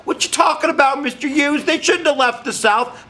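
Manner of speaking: high-pitched, hammed-up Southern accent; mock a Southern student objecting in an overdone Southern accent; bad Southern accent. Teacher voicing an imagined student talking back, hammed-up Southern accent. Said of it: "That was the worst Southern accent ever".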